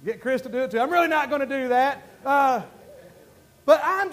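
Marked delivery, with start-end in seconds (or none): none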